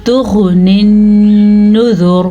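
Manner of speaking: nasal ghunna on the noon, chanted; Quran recitation with ghunna. Shown as a demonstration. Teacher demonstrating ghunna on the doubled noon, chanted. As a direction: hold the nasal hum on the noon. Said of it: "कैसे?".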